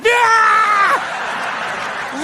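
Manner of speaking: high, drawn-out attack cry; dog attack scream. Retold or imitated. Dog lunging at a stranger.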